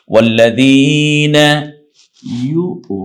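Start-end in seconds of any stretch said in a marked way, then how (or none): 0.1-1.5 ওয়াল্লাযীনা loud and slow with a held ee; one-alif stretch. Shown as a demonstration. Teacher models stretching after the rule, one-alif stretch. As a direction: draw out the যী in ওয়াল্লাযীনা. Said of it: "এক আলিফ টেনে বলবো".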